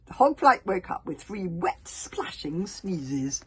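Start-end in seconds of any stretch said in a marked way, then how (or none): none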